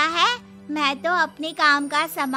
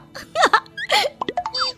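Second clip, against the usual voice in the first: high-pitched mean cackle; mocking laugh. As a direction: laugh her off, high and scornful. Mocking laugh after snubbing new girl. (मज़ाक उड़ाती हँसी)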